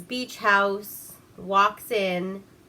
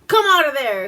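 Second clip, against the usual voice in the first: loud call, higher and louder; 6 semitones higher, 11 dB louder than usual. Retold or imitated. Grant calling to the man hidden in the bushes, loud call. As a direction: order him out of the bushes. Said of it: "he's like".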